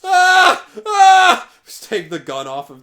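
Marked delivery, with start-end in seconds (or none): 0.0-1.5 high, very loud shocked scream; shrill panicked yell. Real, not acted. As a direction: scream out in alarm. Game shock, yelling.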